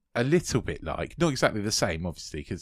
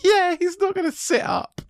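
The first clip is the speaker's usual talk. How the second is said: high and louder, laughing through it; 5 dB louder than usual. Real, not acted. Co-host laughing at the idea of him rising, laughing. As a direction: giggle the words out. Joking about the grave scene.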